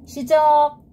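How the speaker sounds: loud sudden command; shouting. Acted. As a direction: call out the start. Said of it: "아이작 박사가 소리쳤어요".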